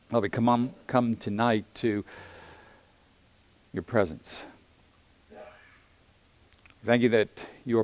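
soft, hushed praying voice; prayer. Real, not acted. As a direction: pray quietly and humbly to God. Pastor leads the congregation in prayer.

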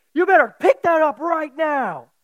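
loud, high barked command; drill sergeant yell. Retold or imitated. Drill sergeant shouting an order at the young cadet. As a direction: bark it like a drill sergeant at a recruit.

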